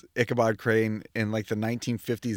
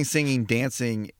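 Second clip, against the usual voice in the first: normal talking voice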